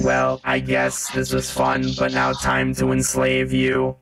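slow, grand evil monologue; gloating villain. Acted. The villain reveals to the party that he rules them, slow and grand. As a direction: savour every word of power. Villain ends talk, attacks.

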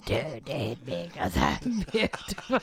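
very low, put-on voice; mock vamp line. Teasing co-hosts with a catchphrase, deep put-on voice. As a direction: drop far down for a mock catchphrase.

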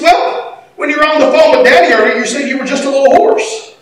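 put-on high child's voice explaining herself; small kid reasoning, pitched well up; little girl. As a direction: imitate a four-year-old's innocent explanation. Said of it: "she's probably four or five".

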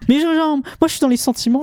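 high-pitched, playful indignation; hammed-up hurt feelings; mock-wounded protest. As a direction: protest at being teased, voice shooting up. Mock protest at being teased.

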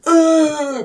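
high, drawn-out zombie moan; mock undead "uuugh"; imitated zombie groan. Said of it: "the bloke just goes".

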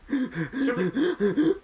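hyperventilating. Real, not acted. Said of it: "I'm hyperventilating".

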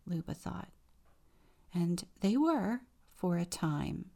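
ordinary delivery, nothing marked

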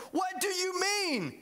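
high, drawn-out baffled exclamation; Nicodemus. Acted. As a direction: exclaim it in hammed-up confusion. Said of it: "exclaimed Nicodemus".

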